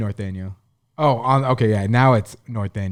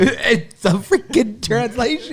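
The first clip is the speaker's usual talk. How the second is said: laughing; 13 semitones higher, 3 dB louder, slower than usual. Real, not acted. Laughing at a search mix-up.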